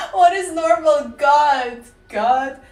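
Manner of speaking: loud exasperated cry; frustrated shout. Real, not acted. Frustrated outburst at herself.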